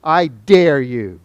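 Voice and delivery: slow, loud challenge; boyish dare. Acted. One guy challenging another growing up, slow loud dare. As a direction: lay down a dare, every word drawn out. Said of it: "What do guys do?".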